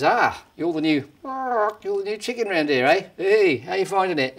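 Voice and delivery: strut in like a cocky rooster; cocky cockerel. Acted. Cockerel greets new hen.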